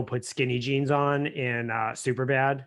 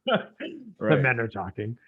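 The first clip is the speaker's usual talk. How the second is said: mock manly gravity; slower than usual.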